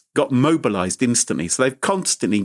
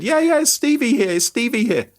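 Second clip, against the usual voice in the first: higher, eager fake Stevie; mock fake grandson; 11 semitones higher than usual. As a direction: seize the name, play along. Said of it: "the guy pretended to be the grandson".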